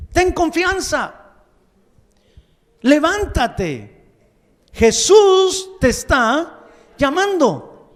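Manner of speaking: loud slow herald's call. Acted. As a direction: summon him with authority. Voicing messengers calling the blind man.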